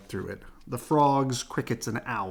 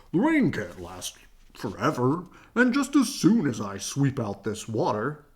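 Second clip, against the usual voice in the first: Papa Bear voice; 4 dB louder than usual. Acted. Papa reassures.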